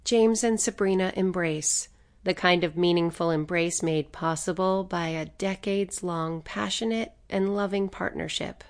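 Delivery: usual speaking voice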